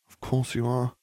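grumbled. A narrator's reading. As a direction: grumble it under your voice. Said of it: "Hermione grumbled".

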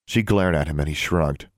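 plain reading voice

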